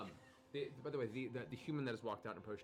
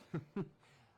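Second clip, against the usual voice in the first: chuckle; 9 semitones higher, 6 dB louder than usual. Acted. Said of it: "Vanessa kind of chuckles".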